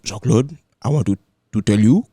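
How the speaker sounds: put-on careful Haitian accent, every syllable; a lightly voiced Haitian uncle. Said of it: "the thickest Haitian accent".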